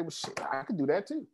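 normal talking voice

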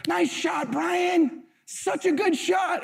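put-on high, slow cheerful little boy praising; sweet kid voice. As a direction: imitate a kind boy cheering a rival. Voicing son praising opponent.

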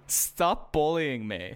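drawn-out comic complaint; mock-wounded plea. Real, not acted. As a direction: plead slowly as the picked-on one. Co-hosts tease him with misquote.

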